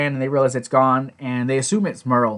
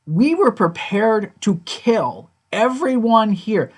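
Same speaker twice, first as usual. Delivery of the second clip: slow, higher, heavily stressed words; grave emphasis; 7 semitones higher, slower than usual. Retold or imitated. Retelling a leader confronting the other over near bloodshed, emphatic. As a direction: spell it out slowly and emphatically.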